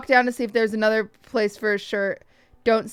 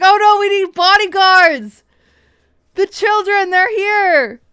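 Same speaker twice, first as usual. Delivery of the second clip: high, loud hammed-up alarm; mock panic; 8 semitones higher, 8 dB louder than usual. Joking to friends about needing bodyguards, loud mock panic. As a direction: play fake terror at the kids. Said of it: "I was like".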